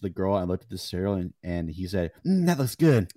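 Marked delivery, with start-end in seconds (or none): 2.2-3.1 loud, raised impression of a creep; Stamper. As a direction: lean into a lip-smacking mmm. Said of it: "Stamper just, like, got right next".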